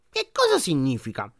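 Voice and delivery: put-on sharp, cold old lady question; cutting tone. A narrator's reading. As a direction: cut in sharply. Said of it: "in tono tagliente". (tono tagliente della nonna)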